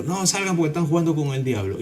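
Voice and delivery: urgent warning. Retold or imitated. Someone breaks up the diabolical game, urgent warning. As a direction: order them out in mild alarm.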